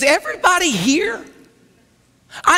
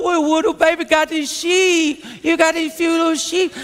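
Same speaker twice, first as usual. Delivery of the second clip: put-on teasing babyish singsong, pitched up; mocking baby talk; 2 semitones higher than usual. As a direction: belittle him like a sneering big brother. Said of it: "why you gotta say it like that".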